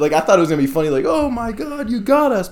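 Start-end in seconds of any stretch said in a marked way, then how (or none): 1.1-2.5 very high-pitched imitation of amused people; hammed-up high reaction; mock delight. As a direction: squeal it like delighted prank victims. Imagined delighted coworkers reacting to prank.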